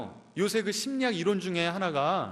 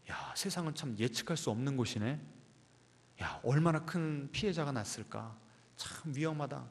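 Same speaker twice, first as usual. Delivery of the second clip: soft, subdued musing; understated, hushed apprehension; fearful inner voice; 3 semitones lower, 8 dB quieter than usual. Acted. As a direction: murmur a worried thought to yourself. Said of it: "그때 그 두려움을 통해서 우리에게 어떤 메시지를 전한다고요".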